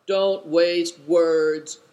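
loud, very slow deep voice; booming, drawn-out man's voice; deep male cry. A narrator's reading. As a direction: call it out slowly, deep and commanding. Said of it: "cried a deep male voice".

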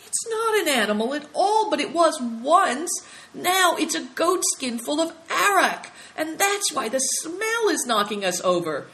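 high, loud excitement; shouted discovery. Acted. Big Tiger realising it is a goat skin, shouting. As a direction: blurt out the discovery, pitched high. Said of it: "he shouted suddenly".

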